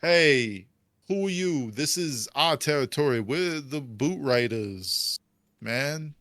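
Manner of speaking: a touch of a swaggering thug voice; tough gang lookout. Acted. Gang guard challenging the party, lightly tough.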